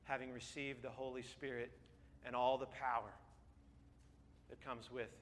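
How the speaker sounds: low, soft, reverent prayer. Real, not acted. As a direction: pray quietly, pausing between phrases. Leads the closing prayer.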